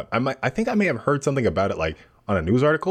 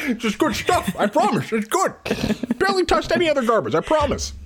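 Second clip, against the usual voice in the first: much higher, pleading little voice; high wheedling voice. Pretend. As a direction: beg them to trust you. Voicing a garbage-eating character.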